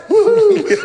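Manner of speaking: a bright, giddy laugh that spills out; high burst of laughter. Real, not acted. Laughing off the shelling with his crew, a high giddy laugh.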